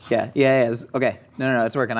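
ordinary talk, nothing marked

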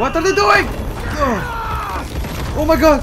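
high-pitched, loud horrified exclaiming; shocked outcry. Real, not acted. Player watching what game characters do, shocked outcry.

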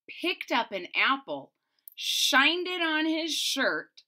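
ordinary delivery

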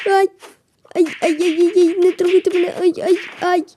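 high fast yelps of panic; hammed-up scared yelps; mock panic. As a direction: squeal as if under attack. Being attacked in game.

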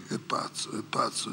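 whispered è pazzo, low and soft; murmured gossip. Retold or imitated. Crowd leaving, whispering that he is mad. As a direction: pass it along under the breath.

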